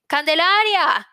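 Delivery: loud, high, drawn-out call. Acted. Old woman calling out from the door. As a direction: call her name across the house. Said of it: "grita desde la puerta".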